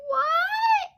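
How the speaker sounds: shrill high disbelieving shriek; high outraged what. Real, not acted. Outrage at being kept.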